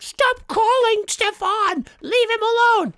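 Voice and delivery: high nagging impression; Bronson voice. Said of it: "the messages he leaves me being like".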